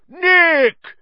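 high, booming hail; roar. A narrator's reading. Headless huntsman hails his host, roaring. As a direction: roar the name out heartily. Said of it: "he roared".

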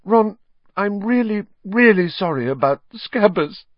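high-pitched, acted sobbing apology. Hermione apologises to Ron, sobbing in a high voice. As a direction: apologise in a high, tearful wail. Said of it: "she sobbed".

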